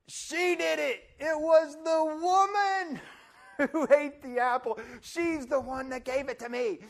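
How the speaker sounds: high, loud accusing shout; Adam shouts the blame onto Eve; blaming shout. Retold or imitated. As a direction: point the finger and yell it.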